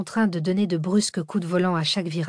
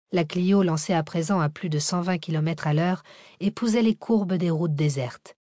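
usual speaking voice

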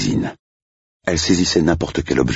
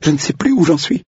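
put-on high, eager young woman's voice; a nervous young bride-to-be, pitched up; flustered young woman; 3 dB louder than usual.

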